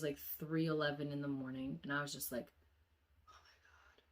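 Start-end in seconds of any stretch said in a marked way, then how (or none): 3.3-4.0 barely voiced, breath-only exclamation; spooked whisper. Seeing it's three eleven, spooked whisper. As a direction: whisper it in dread.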